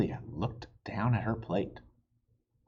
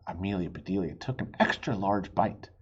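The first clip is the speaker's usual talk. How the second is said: ordinary delivery, nothing marked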